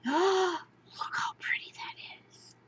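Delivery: gasp, then a breathless whisper; awed whisper. Real, not acted. Unboxing beads, whispering in awe at the chain. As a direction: hush it in wonder.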